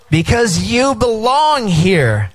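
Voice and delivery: slow, with belong drawn out as the key word; stress on BELONG, slow. Shown as a demonstration. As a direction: stretch out belong, the heart of the phrase. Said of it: "It's all about how you emphasize".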